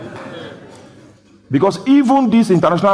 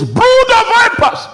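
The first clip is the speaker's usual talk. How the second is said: very high, loud rebuke; preaching shout; 7 dB louder than usual. Retold or imitated. Preacher quoting the sent man's rebuke, high shout. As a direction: cry it out as the sent prophet. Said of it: "he says".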